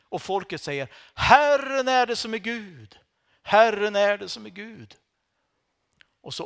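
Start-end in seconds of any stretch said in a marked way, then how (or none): 1.1-5.0 much higher, louder proclamation; loud acclaim. Acted. The people acclaiming the Lord after the fire, loud. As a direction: cry it out in awe.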